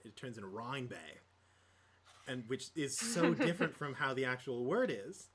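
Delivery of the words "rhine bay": broad Australian vowels, "Rhine Bay". Shown as a demonstration. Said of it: "very stereotypical Australian accent".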